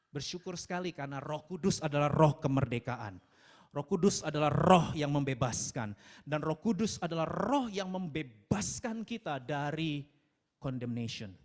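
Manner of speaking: loud, forceful proclamation; emphatic preaching. Real, not acted. Preacher proclaiming freedom to the congregation, loud and emphatic. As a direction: declare it with weight, leaning on "roh".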